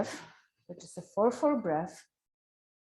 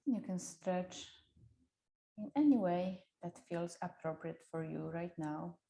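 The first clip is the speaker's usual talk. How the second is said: guided meditation voice; 3 dB quieter than usual. Acted. Guides gentle wake-up.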